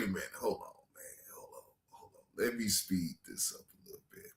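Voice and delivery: very soft, nearly whispered; hushed aside. Real, not acted. Host pausing to speed the clip up, hushed aside. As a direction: murmur it to yourself, barely voiced. Muttered aside while fixing playback.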